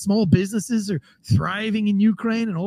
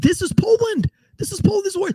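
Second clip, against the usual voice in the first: much higher defiant shout; defiant war cry; 11 semitones higher than usual. Retold or imitated. Defenders of the wall rallying, defiant high shout. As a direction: bellow it like a last stand. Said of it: "They said".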